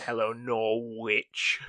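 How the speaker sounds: slow "Hello, Nor-witch" with both syllables spelled out. Retold or imitated. Retelling a pop star greeting her audience, slowly mispronouncing Norwich. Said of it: "I've heard [name] say".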